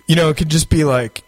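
ordinary talk, nothing marked

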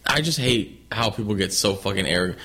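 usual speaking voice

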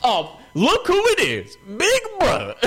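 very high, slow, sugary greeting; high drawn-out mock cheer; gleeful mock greeting. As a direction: fake delight at seeing him. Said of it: "And then, uh".

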